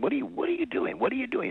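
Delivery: high, incredulous mock yell; baffled shout. Diner man asks why he shot the buffalo, high incredulous yelp. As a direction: squeak it out in disbelief.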